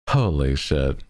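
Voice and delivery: ordinary delivery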